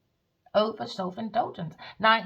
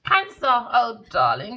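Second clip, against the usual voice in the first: usual speaking voice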